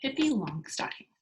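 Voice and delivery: ordinary delivery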